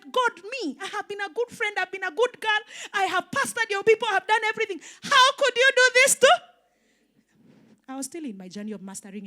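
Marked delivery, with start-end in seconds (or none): 0.0-7.6 retold devastated plea, climbing and swelling to the last question; anguished protest to God. Preacher re-enacting her complaint to God after losing everything, anguished and angry. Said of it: "I was angry, I was devastated".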